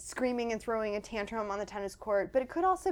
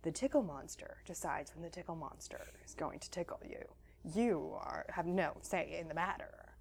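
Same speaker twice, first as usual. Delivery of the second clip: quiet monster decree; 6 semitones lower, 7 dB quieter than usual. Pretend. Parent's monster voice to kids.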